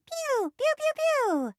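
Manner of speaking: high pew pew laser noises; squeaky toy laser blasts; laser sounds. Acted. Firing forward lasers.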